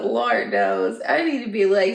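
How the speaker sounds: ordinary delivery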